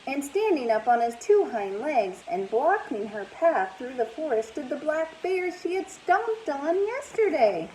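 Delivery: plain reading voice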